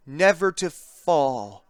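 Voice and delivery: ordinary delivery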